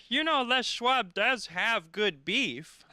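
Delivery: slow, earnest fan voice; deadpan fan insisting, slowly; earnest heckler. Retold or imitated. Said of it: "this guy comes up and he goes".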